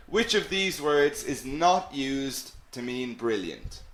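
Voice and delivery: ordinary delivery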